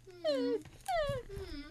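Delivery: high, slow fake whimpering; put-on pleading whimper; mock whimper. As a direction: whimper pitifully like a sad puppy. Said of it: "I'm doing my sad face".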